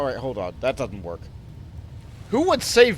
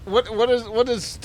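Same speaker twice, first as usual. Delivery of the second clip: ordinary talk, nothing marked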